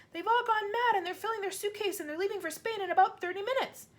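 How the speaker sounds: put-on high, breathless distressed child; a girl blurting bad news; girl crying out. A narrator's reading.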